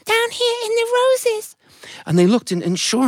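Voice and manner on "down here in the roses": very high, slow tiny voice; a tiny ant calling from the rose bush. Acted. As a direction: miniature squeak. Ant calls from the roses.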